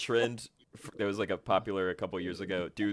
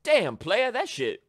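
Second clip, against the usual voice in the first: high, loud mock Guy Fieri hype; Guy Fieri impression; 13 semitones higher, 5 dB louder than usual. Friend mimics Guy Fieri praising a soul food kitchen, high hammed-up hype. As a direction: ham up a celebrity chef praising soul food. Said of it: "He sounds like Gary Owen".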